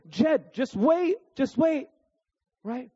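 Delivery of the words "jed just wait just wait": slow, higher, soothing wait; calm the toddler down slowly; patient dad. Retold or imitated. Dad soothes his waiting toddler, slow and gentle. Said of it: "and I'm saying".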